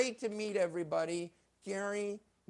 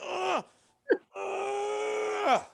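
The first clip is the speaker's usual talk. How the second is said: strained grunt; 13 semitones higher, 5 dB louder than usual. Pretend. Magician straining at trick.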